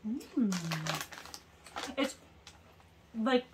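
talking while eating crisps; muffled speech through chewing; mouth full, chewing. Real, not acted. Seen 1: Speaker unclear; woman chews behind hand, man bites crisp then.

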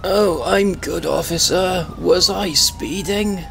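put-on a doddery old Briton protesting politely; old man voice. Old man voice denying to the officer.

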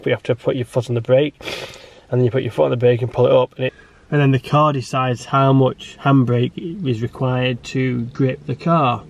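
ordinary talk

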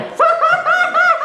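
high squealing burst of laughter; shrieking laugh. Real, not acted. High shrieking laugh after chant.

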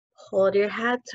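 plain reading voice

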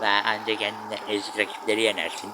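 a hint of a sneering commenter; mocking critics. Gamer imitating viewers who mock his wins, mild sneer. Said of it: "şey diyenler olur".

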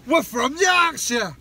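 loud, badly faked Yorkshire accent; attempted Yorkshire accent. Protesting they're not from London, mock Yorkshire accent. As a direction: belt out a hammed-up Yorkshire accent, pitched high. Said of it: "That weren't a Yorkshire accent either".

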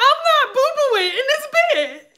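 high, whiny retold protest; high-pitched protest. Acting out her protest in labor.

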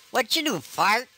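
normal talking voice

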